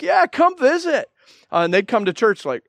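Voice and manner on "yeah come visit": eager invite. Retold or imitated. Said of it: "I'm like".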